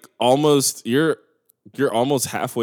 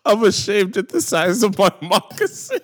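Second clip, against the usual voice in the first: laughing; 10 semitones higher, 5 dB louder than usual. Real, not acted. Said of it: "somebody said".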